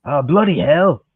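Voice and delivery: put-on British exclamation; mock-British grumble; British accent. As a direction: give it your most British accent. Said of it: "do the most British accent that you can".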